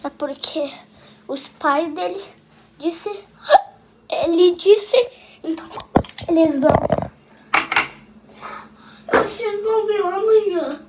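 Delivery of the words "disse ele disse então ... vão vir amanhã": hiccuping. Real, not acted. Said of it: "eu tô com so-, soluço".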